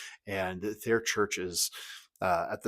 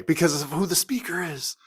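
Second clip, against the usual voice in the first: ordinary talk, nothing marked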